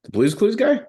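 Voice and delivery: high shocked question; can't believe it; surprised. Real, not acted.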